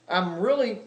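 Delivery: ordinary talk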